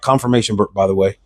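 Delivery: usual speaking voice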